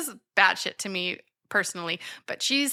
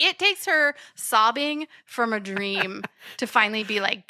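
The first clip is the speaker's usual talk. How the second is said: normal talking voice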